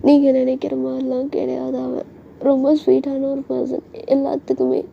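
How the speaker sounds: put-on high, slow girlish voice; pleading daughter. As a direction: plead his case to dad, drawn out. Daughter earnestly defends boyfriend to father.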